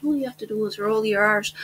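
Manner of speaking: ordinary talk, nothing marked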